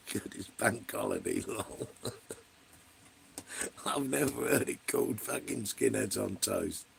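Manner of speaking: laughing through the words. Real, not acted. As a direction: chuckle while reading it out. Laughing at the chat name.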